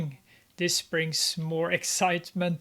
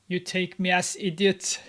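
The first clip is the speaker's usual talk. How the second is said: normal talking voice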